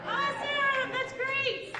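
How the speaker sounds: loud, high cheer; over-the-top excitement. Acted. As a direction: hype it way up. Cheering a bingo player.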